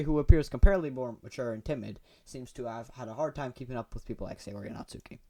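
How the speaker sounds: usual speaking voice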